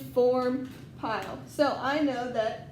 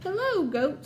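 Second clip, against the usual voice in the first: much higher, chirpy little duck greeting; cheery duck voice; 7 semitones higher than usual. Acted. Teacher voicing Duck greeting the farm animals, high cheerful. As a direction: pop up high as a friendly duck.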